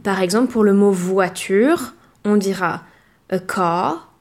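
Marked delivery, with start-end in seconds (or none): 3.3-4.1 British English. Shown as a demonstration. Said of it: "ne se prononce pas".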